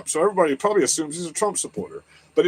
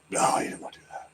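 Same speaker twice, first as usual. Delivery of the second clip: whispered aside; 10 dB quieter, faster than usual. Real, not acted. Unvoiced aside.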